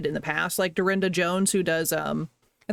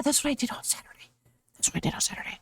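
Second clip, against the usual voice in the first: whispered aside; 5 semitones higher, 5 dB quieter, faster than usual. Real, not acted. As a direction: breath-only whisper to yourself. Whispered aside to self on remembering.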